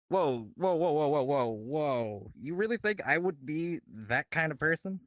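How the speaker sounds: acted startled protest at being accused; mildly shocked. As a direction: play a taken-aback "whoa", then question the accuser. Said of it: "would first look a little shocked".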